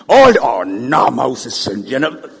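slow loud mock groan. Preacher voicing complainers to Moses, loud slow groan. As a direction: sigh it out like a grumbler.

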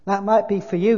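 loud, high-pitched protest; self-righteous big ghost, indignant. A narrator's reading. Ghost defending his life to the spirit, loudly indignant. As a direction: insist on your good record, hard and loud.

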